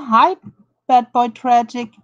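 ordinary talk, nothing marked

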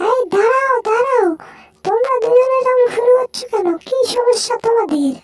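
high, squealing cartoon voice; excitable cartoon character.

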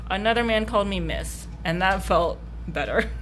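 ordinary talk

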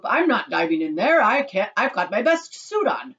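loud, indignant protest; indignant refusal. Acted. Husband refuses to dive in best suit, indignant protest. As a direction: refuse loudly, dragging out the end.

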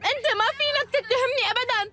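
frantic and tearful, near breaking; crying protest of innocence; hysterical crying denial. Acted. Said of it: "اهدي بقى".

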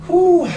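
high, loud breathy exhale; relieved whew. Real, not acted. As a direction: blow out after hard effort. Exhale after finishing a set.

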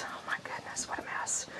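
whispered under the breath; a hushed, whispered aside of dismay. Real, not acted. Owner seeing mud inside the house, dismayed whisper.

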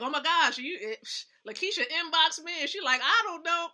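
loud, worked-up gossip voice; breathless, loud and excited; excited retelling. Retold or imitated. Friends retell her nervous messages.

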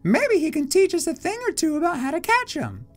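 put-on high, bright-pitched kid voice; young Pokemon character.

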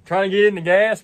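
ordinary talk

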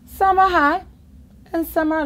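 squeaky and loud on high. Acted. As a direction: jump way up in pitch for high. Reading "high", voiced high.

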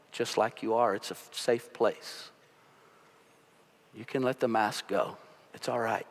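hushed reassuring pastor; tender reassurance. Real, not acted. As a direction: ease them gently into letting go. Pastor comforts congregation softly.

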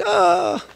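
high, drawn-out mock wail "ah"; high-pitched "ah" of being moved; retold overwhelmed cry. Imitates being moved by the testimony.

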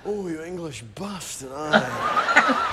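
slow, drawn-out Scottish moans of pleasure; moaning Scotsman. Acted. Comic mimicking a Scotsman being rubbed with chamois, moaning voice. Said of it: "sounds like he's having his genitals rubbed with a warm, wet chamois leather".

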